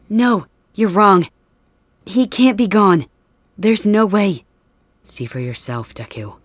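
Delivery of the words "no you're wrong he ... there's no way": higher, louder, slow disbelief; desperate denial. Acted. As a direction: refuse to believe it, voice rising. Refuses to accept friend is dead.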